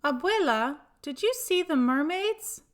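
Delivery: put-on light excited child asking grandma. Child Julian asks grandma.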